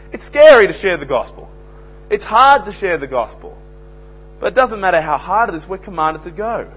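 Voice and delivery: loud, high preaching, slowing on key words; fiery sermon delivery; full-force preaching. Acted. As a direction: thunder it out from the pulpit. Preacher urging listeners to witness.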